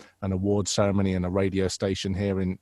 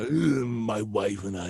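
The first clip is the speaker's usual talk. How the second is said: slow, drawn-out royal impression; impression of an impressionist; 3 dB quieter, slower than usual. Recalls imitating impressionists' Prince Charles, hammed-up impression. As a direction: stretch it out in a put-on Prince Charles voice. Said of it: "doing impressions of impressionists".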